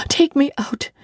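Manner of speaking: high, fast pleading cry; terrified cry. Acted. Child crying to servants to be let out, frightened and high. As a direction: beg to be let out. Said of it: "was my cry".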